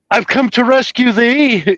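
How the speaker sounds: high-pitched, drawn-out gallant proclamation; mock-heroic Musketeer. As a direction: declaim it like a hammed-up musketeer. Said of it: "I'm yelling right at Serena".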